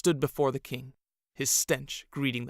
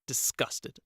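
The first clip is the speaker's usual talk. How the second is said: ordinary delivery, nothing marked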